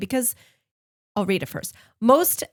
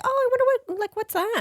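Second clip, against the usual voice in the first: very high wondering voice; puzzled high voice; faster than usual. Retold or imitated. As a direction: wonder aloud, pitched way up. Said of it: "I'm like".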